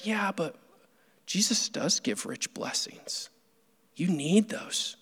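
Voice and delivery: hushed, breathy whisper, slowing at the end; whispering heart. Pretend. Preacher voicing his own tempting heart in a whisper. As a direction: tempt yourself in a barely voiced whisper. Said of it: "And it says".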